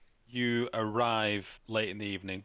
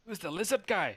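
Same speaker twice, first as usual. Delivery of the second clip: suspicious dwarf; 9 semitones higher, 4 dB louder than usual. Acted. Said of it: "he goes".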